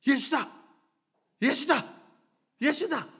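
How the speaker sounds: mild, high-pitched calling out; retold shouting. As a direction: call it out like a passer-by, half-shouted. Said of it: "라고 소리를 지르는 거예요". (살짝 높여 외치는 소리 흉내)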